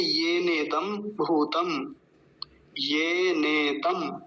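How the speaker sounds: slow teaching demo of ये नेदम भूतम, vowels stretched; syllable by syllable. Shown as a demonstration. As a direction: say ये नेदम slowly, with long ये and ने, closing on a half म. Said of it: "म आधा है". (ये नेदम को धीरे, म आधा रखकर बोलें)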